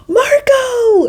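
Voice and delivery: high delighted shout of his name; excited cry. Retold or imitated. Spotting their guide again outside the club, a thrilled high cry. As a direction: call out thrilled to see him.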